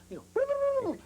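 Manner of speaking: sudden squeaky high gobble, mimicking a turkey; turkey gobble imitation. Photographer retelling how he woke the bird, a high gobbling turkey call. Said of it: "I'm starting to make turkey sounds".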